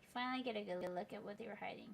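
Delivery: ordinary talk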